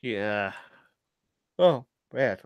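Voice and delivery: speaking through a yawn; yawning while talking. Real, not acted. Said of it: "I can't stop yawning".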